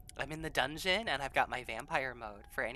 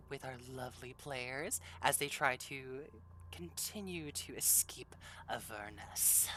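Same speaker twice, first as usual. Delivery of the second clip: ordinary delivery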